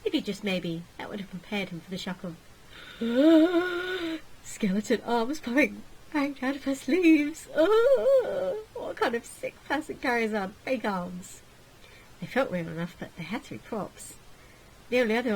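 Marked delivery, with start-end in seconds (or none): 2.7-4.4 big, high drawn-in gasp; long horrified gasp; shocked gasp. Acted. Narrated shock at skeleton arms. 4.4-7.5 slow, higher-pitched shocked reveal; aghast, stretched-out storytelling; horrified narration. Acted. 7.5-11.4 high, loud wail on the first word; hammed-up appalled outcry; horrified reaction. Character reacts to skeleton arms.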